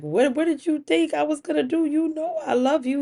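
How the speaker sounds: high-pitched, stumbling protest; defensive protest. Acted. Accused wife answers her husband, defensively protesting.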